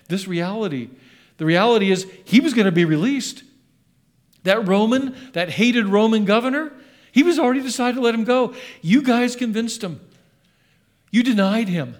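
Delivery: loud, high, accusing preacher; Peter accusing. Retold or imitated. Peter telling the crowd they convinced Pilate, loud and accusing. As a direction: point the finger and press it home. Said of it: "and he's going".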